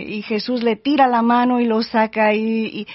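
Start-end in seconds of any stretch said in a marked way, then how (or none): none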